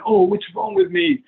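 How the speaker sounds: high, slow mock whine; mock distress. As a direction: whine it as a worried client. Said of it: "instead of going".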